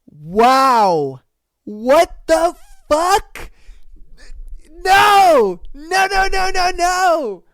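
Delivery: loud, high shocked protest. Real, not acted. Vlogger hearing a cheating story, loud shocked protest. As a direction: refuse to believe it, loudly.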